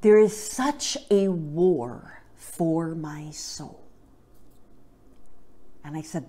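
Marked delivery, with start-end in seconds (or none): 0.0-3.8 very slow, weighted word by word; slow and heartfelt, imitating a tearful confession; grave retold confession. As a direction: draw it out slowly, letting each word land. Retelling brother's tearful confession at altar.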